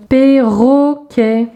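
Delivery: drawn-out teacher's demonstration; syllable by syllable. Shown as a demonstration. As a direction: chant out "Perroquet" in three long beats. Syllable exercise, picture word.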